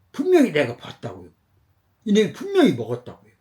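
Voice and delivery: loud, high-pitched protest; indignant insistence. Acted. Bakery owner protests to the boy's father, indignant. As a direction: insist heatedly that you witnessed it.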